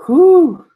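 loud, high mock whew; exaggerated whew. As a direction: blow out a big high whoop, imitating her. Acts out hairdresser seeing the calendar.

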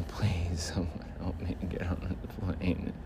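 whispered, nearly silent plea. Real, not acted. Scared flyer begging for help in a whisper. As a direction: beg under your breath. Pleading alone, nervous.

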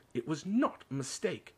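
indignant, insistent retort; angry. A narrator's reading. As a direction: contradict him hotly. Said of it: "said Harry angrily".

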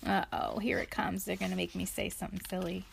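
quick, hushed dread; fast worried mutter. Acted. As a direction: rush it under the breath. Reader dreads silly words.

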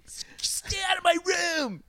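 high, slow yell at the sister; teen yelling. Retold or imitated. Teen yelling at his sister, slow high shout. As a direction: yell it stretched out, bratty. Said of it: "I'll just be like".

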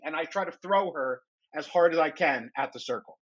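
usual speaking voice

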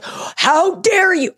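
high, drawn-out mock indignation; mock outrage. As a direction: play the offended host, slowly and high. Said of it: "I went crazy on him".

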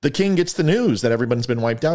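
usual speaking voice

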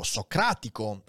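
ordinary talk, nothing marked

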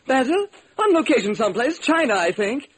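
a director whose star just walked out; frantic Hollywood director. Acted. Director panicking over his star, in a high frantic voice.

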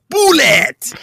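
put-on high loud shout in a Persian accent. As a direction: uncle yelling in a Persian accent. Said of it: "With a deep Persian accent".

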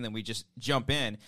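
usual speaking voice

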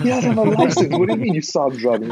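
high, loud mock outrage; incredulous dad. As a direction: imitate a dad's alarmed rapid questioning. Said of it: "my dad was like".

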